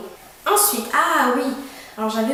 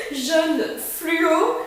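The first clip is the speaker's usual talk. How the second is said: laughing; 7 semitones higher than usual. Real, not acted. Laughs at the neon shirt.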